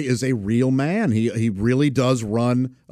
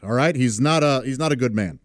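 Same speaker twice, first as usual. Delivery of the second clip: ordinary talk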